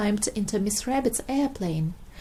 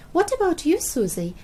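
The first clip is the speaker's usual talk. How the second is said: high; 7 semitones higher, 4 dB louder than usual. Acted. Peppa asking Susie.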